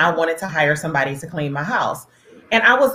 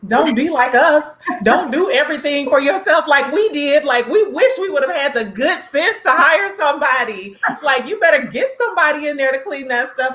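higher, lightly voiced scolding grandma; elders' scolding advice; 8 semitones higher than usual. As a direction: wag a finger at the girl, half laughing. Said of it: "they were like".

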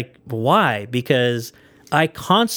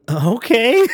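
a high, louder, startled okay; mock surprised okay; 9 semitones higher, 5 dB louder than usual. Call center worker to a rude caller, mock surprised okay. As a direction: act thrown by the rude caller. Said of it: "And I was like".

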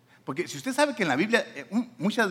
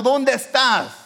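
loud, high calling out; shouting; 7 semitones higher, 7 dB louder, much slower than usual. Acted. God calling to the believer, raised shouting voice. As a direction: shout it out to someone hiding. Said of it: "Te está gritando".

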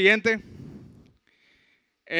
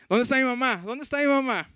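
higher-pitched mock calling for mother; retold waking cry, pitched up, mildly urgent; imitated calling out; 5 semitones higher than usual. Brother waking in hospital asking for his mother, calling out.

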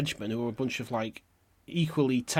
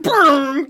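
much louder, much higher rolled Brrrr like a saloon piano; imitated piano rumble; 12 semitones higher, 11 dB louder than usual. Narrator imitates the saloon piano as the fight starts, loud rolled Brrrr. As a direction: do a mock piano jangle with your lips. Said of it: "like that".

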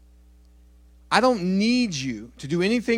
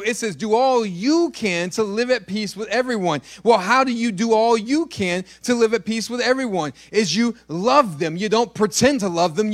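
louder, driving sermon delivery; fervent preaching; 7 semitones higher, 5 dB louder than usual. Acted. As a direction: preach it hard to the congregation. Preaching at full force.

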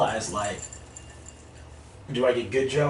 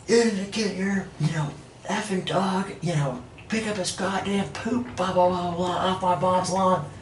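high, slow mock rant of the angry neighbor; imitated lady yelling; 8 semitones higher than usual. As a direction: drag it out high, mocking her tirade. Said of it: "She's yelling so loud".

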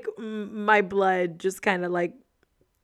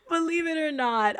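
embarrassed repeat; 8 semitones higher than usual. Real, not acted. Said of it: "I feel so embarrassed admitting that".

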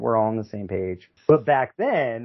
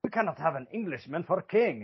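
higher-pitched, softer bad French accent; mangled mock French accent; botched French accent, Scottish-sounding; 7 semitones higher, 5 dB quieter than usual. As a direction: attempt French and drift towards Scottish. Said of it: "That sounded kinda Scottish".

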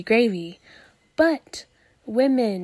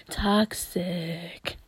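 low whispery echo; 4 semitones lower, 5 dB quieter than usual. Pretend. Repeats the word low after belting.

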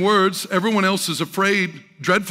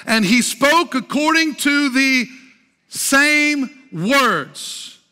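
slow, higher, weighty pulpit reading; declaimed scripture reading; 7 semitones higher, 4 dB louder, slower than usual. Real, not acted. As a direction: read the verse out grandly, stretching key words.

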